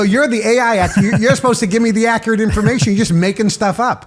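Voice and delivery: fast, high, laughing protest; exasperated scolding. Retold or imitated. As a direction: tell the AI off in mock outrage. Scolding the AI, retold.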